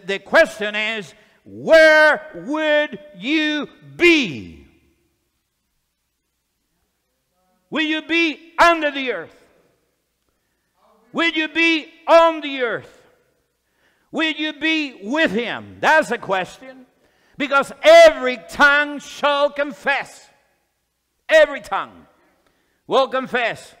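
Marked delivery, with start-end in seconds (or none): 1.4-15.8 high, drawn-out pulpit challenge with long pauses; raised, ringing preacher's questioning; forceful preaching. Real, not acted. 17.4-23.8 loud, emphatic pulpit declaration; emphatic preacher hammering the point; forceful preaching. Real, not acted.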